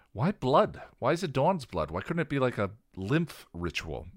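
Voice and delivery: loud, high exasperated huff; huffed, upset. Acted. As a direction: vent the frustration. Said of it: "Xander huffed, upset".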